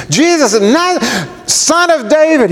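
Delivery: very high, urgent call; pleading cry. Acted. Beggar calling to Jesus as Son of David, pleading. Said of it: "He's pleading with him".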